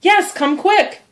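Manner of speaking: very high, drawn-out call; urgent alarm. Acted. Sid begging his aunt to come upstairs, urgent alarm. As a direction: urge her to hurry.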